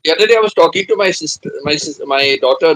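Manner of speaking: usual speaking voice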